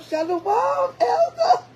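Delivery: high, slow, sugary greeting; mock sweet sister. Sister greeting the elder at camp, high and syrupy. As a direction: lay the sweetness on thick.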